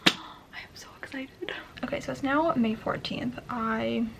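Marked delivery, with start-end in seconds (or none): 0.1-1.5 gasp, then a slow whispered thrill; excited whisper. Real, not acted. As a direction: whisper your delight. Whispered joy after a gasp.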